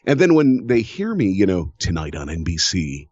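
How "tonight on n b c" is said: low, controlled network promo read; voiceover voice. Shown as a demonstration. Voiceover artist showing friends his network voice, low announcer read. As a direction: drop into an announcer's register. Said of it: "it's not like I'm really putting that voice on".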